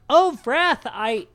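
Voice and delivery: high-pitched hollering in character; wołanie. Acted. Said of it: "he hollers out".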